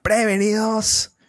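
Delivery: high called-out set countdown; mock director shouting before a take; shouted countdown. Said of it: "es como gritar".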